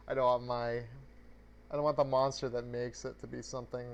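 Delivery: ordinary delivery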